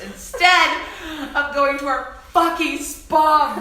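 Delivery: slow, rising angry rant; venting, dragging out the grievance; pissed off. Retold or imitated. Girl venting to friends about grandma, slow angry rise. Angry retelling of airport fight.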